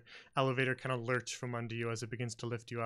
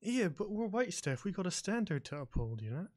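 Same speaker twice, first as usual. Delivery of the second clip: disapproving boss; 6 semitones higher than usual. Acted. Edward lectures fellow waitstaff on standards, in disapproving voice. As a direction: lecture a junior in a higher character voice. Edward keeps scolding the waiter.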